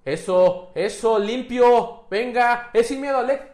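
very loud, rising hype-man cry; motivational shout. Retold or imitated. Showing friends' cry when lifting things, motivational shout. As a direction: yell it slow and hard to drive the lift. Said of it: "el grito de".

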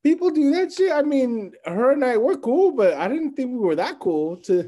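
high, loud retold disbelief; incredulous. Retelling own incredulous reply.

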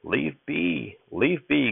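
a lightly voiced old grandfather snapping back; old man's shout. A narrator's reading. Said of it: "Grandfather shouted".

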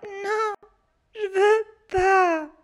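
put-on child whimpering no; whiny refusal. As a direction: drag it out like a frightened child. Scared boy refusing.

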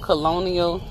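normal talking voice

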